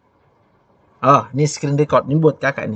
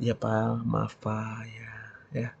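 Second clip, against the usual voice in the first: very soft, low submissive reply; meek gentle apology; 4 semitones lower, 12 dB quieter, much slower than usual. Acted. Wife apologising to angry husband, meek and hushed. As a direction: placate softly, almost under the breath. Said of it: "Jawaban yang lemah lembut".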